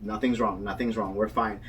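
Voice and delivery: soft, low, calming voice; imitating a soothing friend. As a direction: hush and reassure a panicking friend. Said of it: "She was like".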